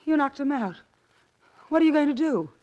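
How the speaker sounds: panicking as the plan falls apart; alarmed outburst. Acted. Accomplice panicking.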